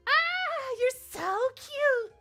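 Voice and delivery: put-on high, gushing anime girl squeal; cute anime girl voice. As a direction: squeal over something adorable. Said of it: "Your anime girl voice is good".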